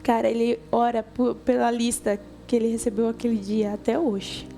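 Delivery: ordinary talk, nothing marked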